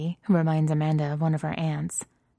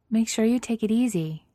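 frowning concern; 6 semitones higher than usual. A narrator's reading. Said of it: "frowning at her".

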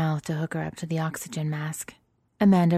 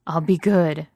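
croaking; 7 dB louder, slower than usual. A narrator's reading. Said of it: "she croaks".

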